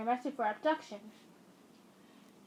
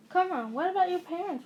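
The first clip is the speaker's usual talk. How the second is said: surprised protest; 6 semitones higher, 5 dB louder than usual. Acted.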